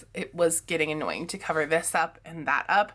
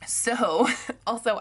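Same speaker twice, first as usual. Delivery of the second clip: usual speaking voice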